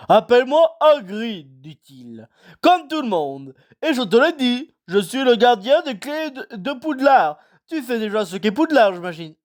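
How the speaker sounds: big gruff giant pitched high and slow; Hagrid voice. A narrator's reading. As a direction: play the kindly giant, unhurried.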